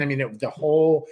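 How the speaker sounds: ordinary delivery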